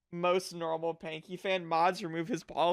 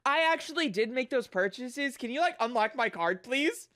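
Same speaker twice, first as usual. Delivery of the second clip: polite phone voice; 6 semitones higher than usual. Retold or imitated. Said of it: "be like".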